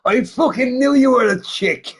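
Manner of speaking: high, gloating outburst; triumphant. Retold or imitated. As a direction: crow that you were right. Dude triumphant at a girl's voice.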